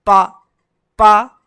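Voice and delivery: पा popped out loud; loud clipped pa drill; phonic p sound. Shown as a demonstration. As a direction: burst out पा with a puff. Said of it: "P को पढ़ते हैं".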